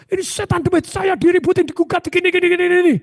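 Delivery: high hammed complaint; high mock grumble of a worried partner; upset partner. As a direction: vent troubles in a high put-on voice. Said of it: "Bapak ada gundah gulana".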